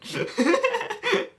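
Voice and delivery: shrill giggle burst; high squealing laugh. Real, not acted. Laughs about the German redeem.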